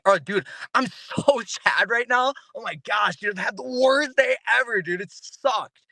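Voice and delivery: high-pitched, gleeful report of misery; mismatched upbeat complaint. Acted. Speaker acts telling a friend about the worst day, absurdly upbeat. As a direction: sound thrilled while saying sad words. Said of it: "it doesn't even compute right".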